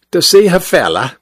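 a light Cockney maid's voice. A narrator's reading. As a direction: clip it like a London housemaid. Maid quoted, Cockney.

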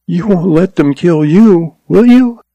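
put-on a young schoolteacher, earnest and upset; young woman's voice. A narrator's reading. Lois voiced, fearful.

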